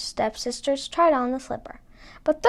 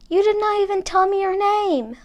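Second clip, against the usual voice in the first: calling after her; 8 semitones higher than usual. A narrator's reading. Prince calling after Cinderella.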